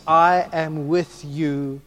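I am with you drawn out very slowly; slow emphasis. Shown as a demonstration. Preacher savouring Jesus's words, very slow. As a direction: stretch each word of I am with you. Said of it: "listen to what it actually says".

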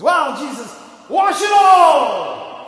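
high, loud, stretched-out cry of wash it all; Peter's eager shout. Retold or imitated. Peter shouting to Jesus to wash him completely, high and loud. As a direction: Peter overdoing it, shouting to be washed all over. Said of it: "Peter, says".

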